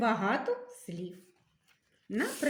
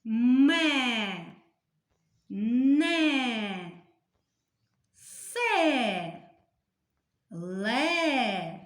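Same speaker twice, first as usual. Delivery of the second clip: syllables called out louder, slow and separate; loud syllable reading; 6 dB louder, much slower than usual. Shown as a demonstration. Teacher reading syllables to pupils, loud and slow. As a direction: chant each syllable for the class to follow. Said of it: "читаємо третій стовпчик".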